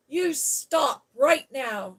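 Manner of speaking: loud, slow shouted order; almost shouting. Acted. Mary shouts at Colin to stop. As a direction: bark it at the screaming boy. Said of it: "she almost shouted".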